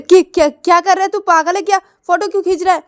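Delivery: high, loud are-you-crazy outburst; irritated protest. Acted. Girl yells at boy taking her photo, irritated. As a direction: stop him snapping the photo.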